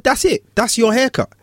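high, gentle sing-song coaxing; coaxing child. Retold or imitated. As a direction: barber coaxing the scared boy to touch the clippers. Reassuring child.

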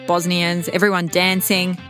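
usual speaking voice

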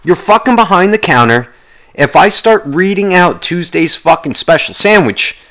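loud, high-pitched rant; really getting angry. Real, not acted. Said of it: "am I really getting angry at that".

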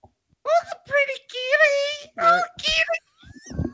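very high cooing voice for a cat; kitty voice. Pretend. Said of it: "Can you still do the kitty voice?".

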